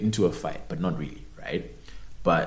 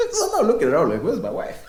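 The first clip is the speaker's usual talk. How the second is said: laughing; 12 semitones higher, 5 dB louder, much faster than usual. Real, not acted. Laughing while retelling.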